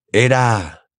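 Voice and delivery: ordinary delivery, nothing marked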